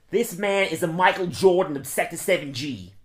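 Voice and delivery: a light character voice for the quotes; lightly voiced quiz impression; Mike Wegman impression. Quiz for the cohost, lightly voiced Wegman. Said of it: "I'm doing Mike Wegman".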